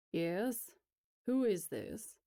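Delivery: put-on wary, guarded old man; suspicious. Elias picking up to an unknown caller, suspicious. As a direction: question the caller with distrust. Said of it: "He sounds suspicious as hell".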